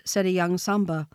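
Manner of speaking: usual speaking voice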